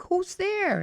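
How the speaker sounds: put-on high frail old lady voice, very high pitched; old woman. Lena asking who is there, in a high frail old voice. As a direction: squeak it like a frail ninety-eight-year-old.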